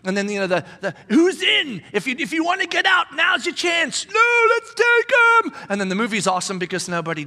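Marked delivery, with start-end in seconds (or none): 1.1-4.0 loud, high battle-speech call; rally the troops before the charge; hammed-up movie general shouting to his army; rallying commander. 4.1-5.7 high, loud, gung-ho reply; mock war-movie soldier, high and loud; eager soldier. Soldier answering movie commander.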